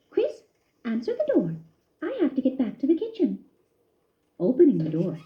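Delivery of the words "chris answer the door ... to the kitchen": a mother puzzled at the knock; lightly voiced mother; Mama wondering. A narrator's reading. Mama calls to son.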